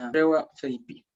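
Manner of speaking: "Rewa feipi" said clearly as a Mapudungun model for the class; Mapudungun model phrase. Shown as a demonstration. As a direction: read "Rewa feipi" out as a native model for students to repeat. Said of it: "Mapudungun meu".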